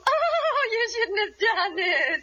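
high, drawn-out gushing Oh; gushing thanks. Acted. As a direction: gush coyly over a gift, stretching it out. Coy thanks for a gift.

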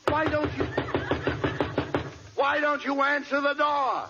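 high, insistent call at the door; exasperated demand. Acted. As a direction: demand to be let in. Exasperated, knocking again.

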